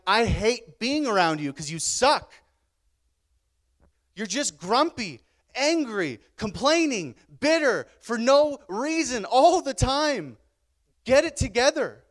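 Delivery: loud, high imitation of a fed-up girlfriend; acted-out angry telling-off, slow and emphatic; exasperated rebuke. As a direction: scold hard, drawing out each complaint.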